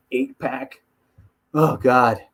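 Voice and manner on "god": ordinary talk, nothing marked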